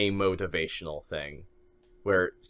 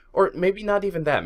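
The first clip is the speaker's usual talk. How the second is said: sudden high correction; 11 semitones higher, 4 dB louder than usual. Real, not acted. Podcaster correcting himself, pitched high and bright. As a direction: jump way up in pitch, louder.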